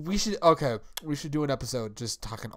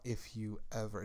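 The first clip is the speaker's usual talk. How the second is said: soft menacing threat; 4 semitones lower, 13 dB quieter than usual. Retold or imitated. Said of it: "when he gets pissed".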